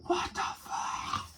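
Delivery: slow whispered what the fuck; slow, stunned whisper; whispered shock. Retold or imitated. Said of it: "He's like".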